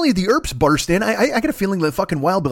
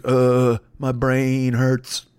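dazed; 4 semitones lower, much slower than usual. Retold or imitated. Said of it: "he's like".

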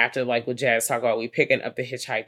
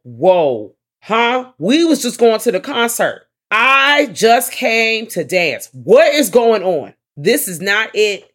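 higher and louder, disbelieving rant; outraged disbelief; 6 semitones higher, 6 dB louder than usual. Retold or imitated. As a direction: play the partygoer who just came to dance. Voicing van passenger's disbelief.

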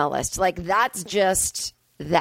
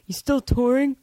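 mocking, higher nosy voice; snide nosy questioner; 4 semitones higher, slower than usual. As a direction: imitate a judgy dentist asking about touring. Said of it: "people who go".

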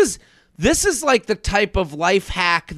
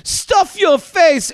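put-on German accent mom; 4 semitones higher than usual. S1 mimics a German mom mocking Americans in a German accent. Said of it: "like a woman with a German accent talking".

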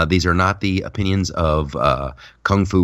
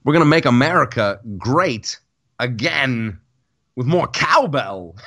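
hint of Walken, higher and laughing through it; Christopher Trump; 4 semitones higher than usual. Comedian trying out the cowbell joke for his cohost, mock Walken. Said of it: "I'm gonna write that down".

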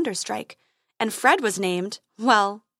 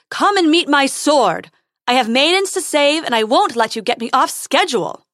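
heroic shouting; 4 semitones higher, 8 dB louder than usual. Acted. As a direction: shout it out, pompous and proud. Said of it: "he called again".

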